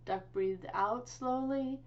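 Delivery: usual speaking voice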